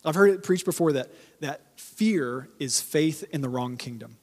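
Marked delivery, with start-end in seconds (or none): 1.4-4.2 each word weighed out slowly; slow emphatic. Shown as a demonstration. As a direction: let every word land, slowly. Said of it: "Let that sink in".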